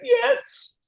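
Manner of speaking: a very high, tearful yes; a yes that cracks upward through tears; retold crying yes. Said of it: "I'm crying".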